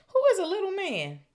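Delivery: high, loud outraged squeal; indignant shriek. Acted. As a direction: screech it in offense.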